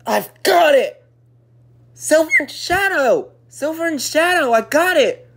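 loud, thrilled eureka; excited. Acted. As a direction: blurt the idea with delight. Excited idea.

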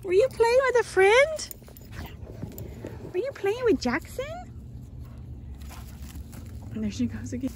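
excited pet talk; dog-praise voice. Real, not acted. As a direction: gush over the dogs. Praising playing dogs.

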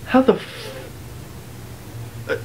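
breathless, awed whisper; stunned whisper. Acted. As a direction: gasp it out in disbelief. Stunned by the magic.

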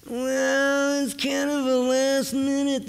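high, slow, whiny put-on voice; whiny reluctant caller. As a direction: drag it out like a reluctant whiner. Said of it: "reminded me of the Weiner family".

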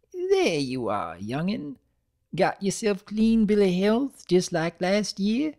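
put-on high, creaky elderly mare; an old granny fussing; lightly hammed-up old grandmother. A narrator's reading. Granny Smith speaks.

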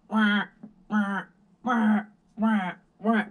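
high, loud alarm whoop; imitated fire alarm. Waking up to the building's fire alarm, high loud wah imitation. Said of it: "our building's fire alarm".

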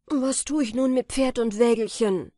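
lauter, höher, wütender Aufschrei; zorniger Ausruf. Acted. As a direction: schleudert dem Glasmännlein die Frage wütend entgegen. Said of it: "Da geriet er in Zorn und Eifer". (laut und zornig)

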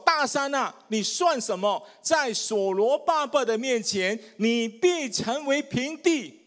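slow, rising proclamation; preached scripture. Acted. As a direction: declare it over the mountain. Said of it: "所以你看圣经怎么说".

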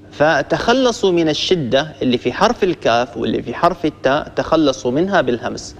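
normal talking voice

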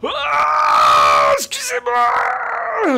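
long, slow, high shout of rage; held-back rage scream. Real, not acted. Gamer apologizing for his rage scream, slow high yell. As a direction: drag out a strained scream. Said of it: "Je force pas trop".

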